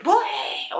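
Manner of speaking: very high, goofy gag noise; silly bleh. Retold or imitated. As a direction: blurt it like a cartoon. Silly gross-out moment.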